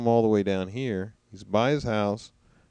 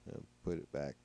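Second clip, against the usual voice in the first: normal talking voice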